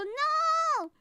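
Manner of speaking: loud high squeal of no; shouted no. Real, not acted. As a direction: yelp it out in protest. Shouted refusal.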